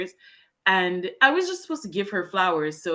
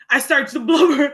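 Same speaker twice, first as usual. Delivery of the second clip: high, loud embarrassed laugh; a real laugh breaking into the line; laughing through it; 8 semitones higher, 10 dB louder than usual. Real, not acted. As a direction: laugh it out, higher and louder. Laughs while recalling her crying.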